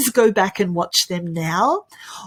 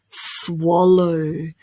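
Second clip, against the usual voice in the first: ordinary talk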